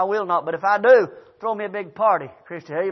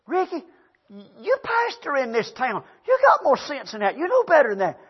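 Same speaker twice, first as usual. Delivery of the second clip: scolding wife; 5 semitones higher than usual. Retold or imitated. Wife to pastor husband after his outburst, scolding. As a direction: imitate a wife scolding, pitched up.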